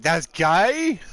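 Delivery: loud, high mock disbelief; retold disbelief. Player retelling his own reaction, in mock disbelief. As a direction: replay your shocked question, rising high at the end. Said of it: "I'm like".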